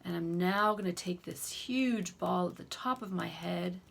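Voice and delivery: guided tapping affirmations. Shown as a demonstration. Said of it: "So let's do an example".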